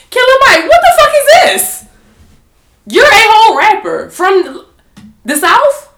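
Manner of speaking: high, loud exasperated callout; mock callout. Calling out [name]'s political talk, high and loud. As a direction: yell it at him in disbelief. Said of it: "And I'm just like".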